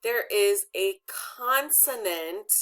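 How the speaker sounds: slow, measured read-aloud, word by word; deliberate pacing with pauses between phrases; reading along together. Shown as a demonstration. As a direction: lead a class reading slowly in unison. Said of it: "can you guys read this with me?".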